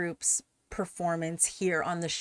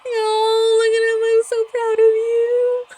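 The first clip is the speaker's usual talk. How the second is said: much higher, louder burst of pride; proud excited cry; 10 dB louder than usual. Real, not acted. Watching her favourite member perform, an overjoyed, proud cry. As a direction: gush over him like a proud fan.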